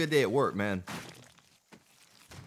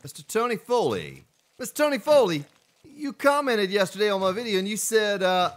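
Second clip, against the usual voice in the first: high, loud call-out to a viewer; exuberant, raised-voice greeting; loud hailing; 11 semitones higher, 7 dB louder than usual. Real, not acted. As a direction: announce the name with a big, high voice.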